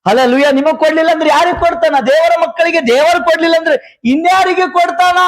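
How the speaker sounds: rousing sermon delivery, rising and driving; fervent preaching. Real, not acted. Pastor preaching to the congregation about God's gifts, fervent. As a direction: preach it to the believers with conviction.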